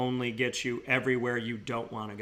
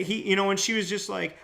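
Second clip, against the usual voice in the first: normal talking voice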